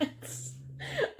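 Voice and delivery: a quick unvoiced giggle, way up high; breathy laugh. Real, not acted. Vlogger laughing at herself, breathy giggle. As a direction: laugh it off, airy and light. Embarrassed laugh mid-thought.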